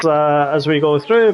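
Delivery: ordinary delivery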